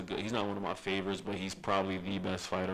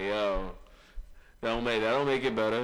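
louder, mocking voice of someone waving off an excuse; mock retort; 3 semitones higher, 5 dB louder than usual. Cohost mocks the excuse, loud and mocking. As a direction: scoff it back at him, louder, in a put-on voice. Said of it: "you can't just say that and be like".

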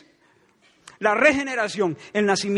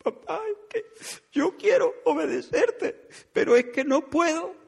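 put-on high little girl's voice, much higher, half-crying; imitating sobbing daughter; 10 semitones higher than usual. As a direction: play the tearful child pleading with dad. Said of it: "me dijo entre sollozos". (imitando a la hija sollozando, voz aguda de niña)

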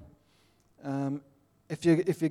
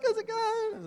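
very high Aussie greeting back; exaggerated Aussie; slower than usual. Fellow Aussie answers the greeting abroad, high exaggerated Australian accent. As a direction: echo the hello in squeaky broad Australian. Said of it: "fifty percent more Aussie".

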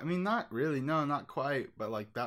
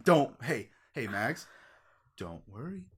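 infomercial; 2 semitones lower than usual. Pretend. Soothing pitchman.

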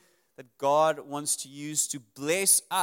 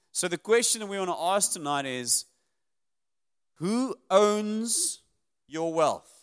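normal talking voice

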